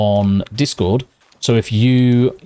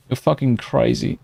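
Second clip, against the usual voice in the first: ordinary delivery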